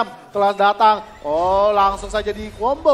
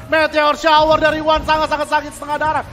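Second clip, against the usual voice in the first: excited; 6 semitones higher, 5 dB louder than usual. Real, not acted. Caster calling heavy damage.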